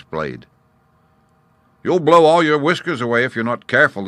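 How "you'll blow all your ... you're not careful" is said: ordinary delivery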